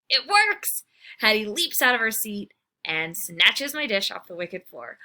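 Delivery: usual speaking voice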